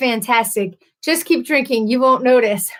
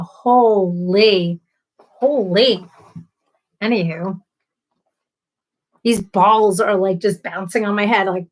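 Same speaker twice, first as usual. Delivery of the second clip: normal talking voice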